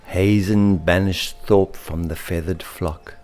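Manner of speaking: plain reading voice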